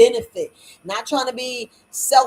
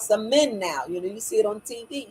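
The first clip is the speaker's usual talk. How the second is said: usual speaking voice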